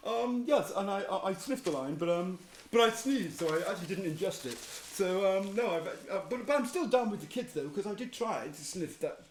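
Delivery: rambling put-on Boris voice full of ums and stammers; mock Boris fumbling a confession, stuttering on co-co-cocaine; Boris Johnson impression. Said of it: "he talks like he's had a stroke".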